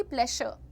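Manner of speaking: प्लेजर as plesher, hushed sh. Retold or imitated. Teacher imitating how they used to say it, plesher for प्लेजर. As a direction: say प्लेजर with an unvoiced sh. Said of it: "श पे रुकती थी मैं".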